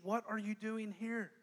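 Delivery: quiet and slow; soft questioning. Acted. God gently asking Elijah, quiet and slow. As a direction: ask it gently. Softly voicing God's question.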